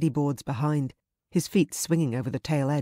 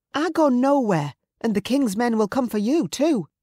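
high-pitched, agitated defiance; defiant sister; 9 semitones higher, 5 dB louder than usual. Acted. Sister refusing to go with the raider, high defiance.

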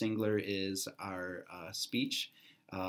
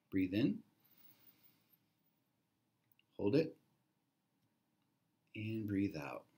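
calm breathing exercise cues, long pauses; guided breathing. Real, not acted. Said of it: "Let's just focus on our breathing".

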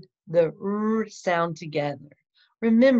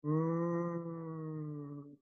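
R sound demo; 12 dB quieter than usual. Shown as a demonstration. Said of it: "make the sound".

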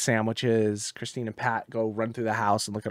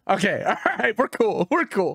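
laughing reassurance; 7 semitones higher than usual. Retold or imitated. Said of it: "I was like".

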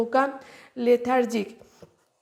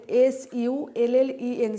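slow letter-by-letter spelling; teacher spelling sullen for students; much slower than usual. Shown as a demonstration. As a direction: dictate each letter clearly, one at a time. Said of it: "সালেন".

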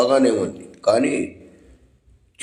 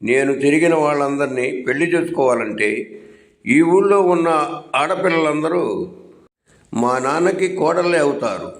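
ordinary delivery

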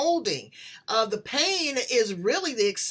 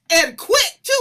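much louder, laughing through the words; laughing excitedly; 6 semitones higher, 10 dB louder than usual. Real, not acted. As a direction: burst out laughing as you say it. Laughing while telling answered prayer.

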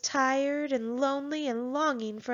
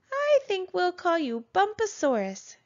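higher, lightly voiced mother dinosaur; name the baby tenderly, a hint of mother; mother dinosaur naming her baby, lightly voiced; mother's voice; 8 semitones higher, 4 dB louder than usual. A narrator's reading. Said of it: "she said".

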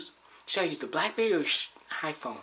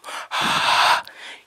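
long, loud voiceless exhale; loud breath out; 10 dB louder than usual. Pretend. Taunting the listener, loud blown breath. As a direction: blow out a big gust of air. Blowing out breath while whispering.

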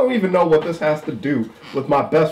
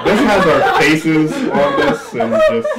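laughing through the words, higher and louder; real laughing; 5 semitones higher, 4 dB louder, slower than usual. Real, not acted. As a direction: crack up while saying it. Players crack up at the card.

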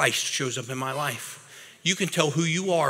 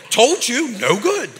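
high, gloating "told you"; sing-song it up high, rubbing it in; teasing told you; 8 semitones higher, 6 dB louder than usual. Acted. Preacher teases the volunteer about Snapchat, gloating.

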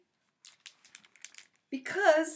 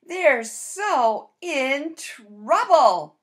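slow emphasis; 6 dB louder, much slower than usual. Acted. Mock dramatic doom.

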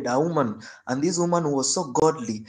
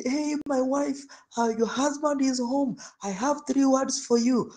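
calling husband; 10 semitones higher than usual. Retold or imitated. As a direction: plead at the window. Husband at the bedroom window.

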